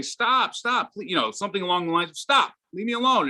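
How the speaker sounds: put-on high, childlike pleading voice; child pleading. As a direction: whine it like a small boy being hit. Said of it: "My grandson starts to sort of say".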